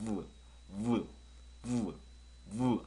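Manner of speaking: short buzzing V into 우, with pauses; lip-bitten V sound. Shown as a demonstration. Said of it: "아래 입술을 살짝 깨물으면서".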